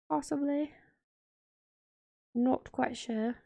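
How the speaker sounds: jokey, drawn-out British affectation; British accent. Pretend. Planner vlogger being funny for viewers, mock British accent. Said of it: "doing a British accent".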